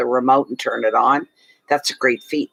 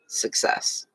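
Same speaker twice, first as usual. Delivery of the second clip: Success whispered softly, no voice; 8 dB quieter than usual. Shown as a demonstration. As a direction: breathe the word Success as a whisper. Repeats keyword, then whispers it.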